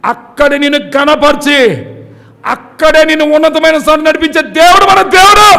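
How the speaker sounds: slow, swelling, loud proclamation; preaching at full force. Acted. Pastor proclaiming God's lifting power to the church, loud and high. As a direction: rise to a ringing amen. Closing climax before prayer.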